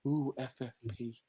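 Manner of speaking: hushed, low mock-spooky murmur; mock awe. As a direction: say it very softly, as if mock-impressed. Mocking how the fanbase was cued.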